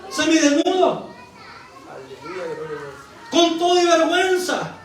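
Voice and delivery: high, insistent sermon voice; impassioned preaching. Real, not acted. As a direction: proclaim it forcefully to the congregation. Preaches at full force. (predicación ferviente con voz elevada)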